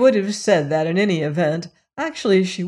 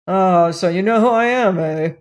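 louder, gruff visitor voice; rough colonial; 5 dB louder than usual. A narrator's reading. Robert challenging the maid, loud and gruff. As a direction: push the words at her, coarse and loud.

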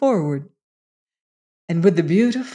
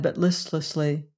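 ordinary delivery, nothing marked

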